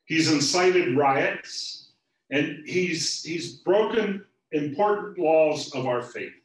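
loud, high charge, slowing on key words; loud accusation. Acted. Chief priests accusing Jesus to Pilate, loud and indignant. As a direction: denounce him to the governor.

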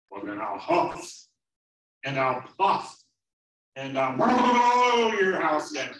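huffing build to a long loud blow; threatening wolf. Acted. Wolf threatening the pig, building to a bellow. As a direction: puff the key words, then stretch blow high and loud.

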